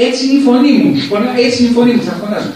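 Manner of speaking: high, loud mock protest; imitated shouting. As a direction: act out an indignant arguer shouting back. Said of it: "«Μη φωνάζεις".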